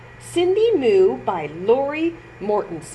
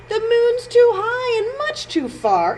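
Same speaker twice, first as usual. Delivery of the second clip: high, louder scoffing voice; scoffing cow; 12 semitones higher, 4 dB louder than usual. Acted. Cow Honey Bun scoffing at Cindy's moon dream. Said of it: "said Honey Bun".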